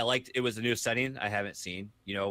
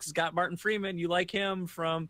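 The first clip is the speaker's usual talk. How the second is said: coaxing pitch; 7 semitones higher than usual. Retold or imitated. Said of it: "I was like".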